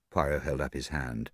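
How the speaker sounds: plain reading voice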